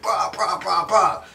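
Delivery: high, loud mock brawl noises; fight noises. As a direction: bash out cartoon fight sounds.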